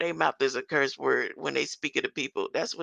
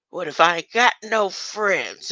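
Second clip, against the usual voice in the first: normal talking voice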